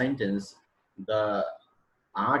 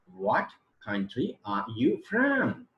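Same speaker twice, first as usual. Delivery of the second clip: drawn out word by word, raised; slow demonstration reading; 4 semitones higher, slower than usual. Shown as a demonstration. Teacher reading the example question for students, slowly spelled out. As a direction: read the question out slowly, stretching each word. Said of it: "is the question".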